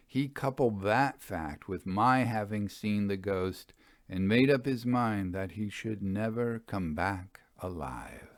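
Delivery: ordinary delivery, nothing marked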